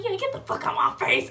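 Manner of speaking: loud, high mock snap; angry dismissal. Mom throwing daughter out of her sight, angry. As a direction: send them away like a furious mom.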